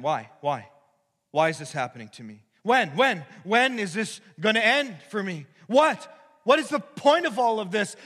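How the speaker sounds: high, loud whining questions; mock complaining. Preacher voicing the congregation's grumbling, whiny and loud. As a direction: voice the complainer's frustrated cries. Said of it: "And we complain".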